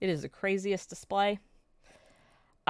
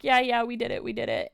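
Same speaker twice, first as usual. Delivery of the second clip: scared; 5 semitones higher, 4 dB louder, faster than usual. Retold or imitated. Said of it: "They are terrified".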